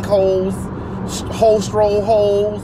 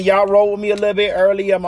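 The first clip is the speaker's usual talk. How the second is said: normal talking voice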